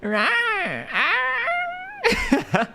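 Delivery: very high drawn-out little roar; tiny tiger, puppyish and high; playful tiger roar. Pretend. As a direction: hold a squeaky rawr till it tips into laughter. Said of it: "I sound like a puppy?".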